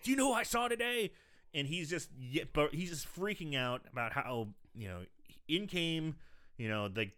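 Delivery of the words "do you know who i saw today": panicked. Retold or imitated. Panicked doctor on phone.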